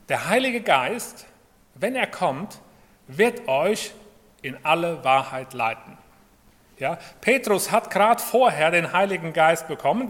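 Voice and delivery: ordinary delivery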